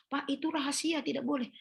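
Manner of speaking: curt refusal. Retold or imitated. Nurse refusing to tell the patient, mock curt refusal. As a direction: shut the patient down sharply. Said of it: "Jangan katakan".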